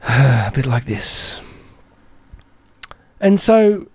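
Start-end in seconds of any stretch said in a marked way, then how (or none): 0.1-1.4 low, slow words after a heavy sigh; slumped. Shown as a demonstration. Listener admitting poor posture, demonstrated slump. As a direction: sigh and let the voice sag down. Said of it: "Maybe I have been a bit slumped".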